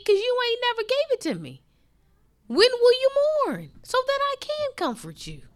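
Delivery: high, pleading voice of the Lord; Jesus answering the complainer, pleading; voicing Jesus.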